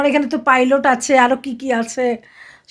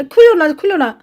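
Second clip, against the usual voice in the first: urgent pleading; 5 semitones higher than usual. Real, not acted. Mother stops child undoing hair.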